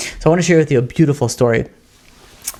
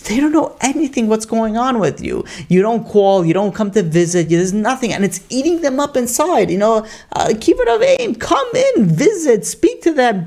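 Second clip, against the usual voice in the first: pleading brother, voice climbing much higher; urgent pleading; 7 semitones higher than usual. Retold or imitated. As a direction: beg her, pitch rising to a peak.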